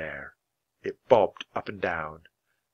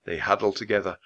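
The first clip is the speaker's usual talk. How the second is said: ordinary delivery, nothing marked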